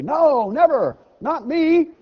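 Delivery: high, loud protesting believer; a congregant's high indignant denial; mock indignant denial. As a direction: play the shocked "not me" believer. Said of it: "we would immediately say".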